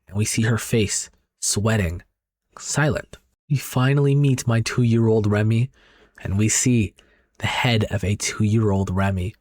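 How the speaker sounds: ordinary delivery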